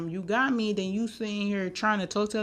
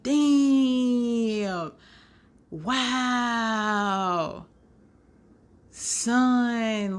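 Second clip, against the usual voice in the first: mock stunned; 2 semitones higher, much slower than usual. Shocked by the reveal.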